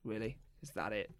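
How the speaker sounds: car voice. Retold or imitated. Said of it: "your car's just like".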